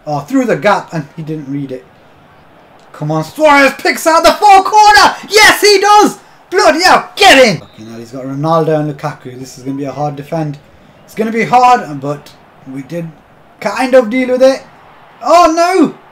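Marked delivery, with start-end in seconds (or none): 2.9-7.6 real excited yell, much higher and louder; goal celebration. Real, not acted. 15.2-16.0 sudden high, loud "Oh, no!"; dismayed cry. Real, not acted. Opponent attacking his goal.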